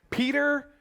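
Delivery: ordinary talk, nothing marked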